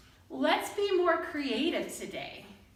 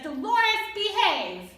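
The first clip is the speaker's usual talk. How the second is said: loud, higher scolding call; yelled; 6 semitones higher, 5 dB louder than usual. Shown as a demonstration. Fernando telling Dolores to behave, yelled. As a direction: tell her off sharply. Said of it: "where Fernando yells".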